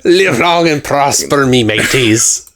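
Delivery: slow, hammed-up pirate greeting; mock pirate accent. Saying goodbye to listeners, light pirate accent. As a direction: sign off like a cartoon pirate. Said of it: "This is directly a pirate accent".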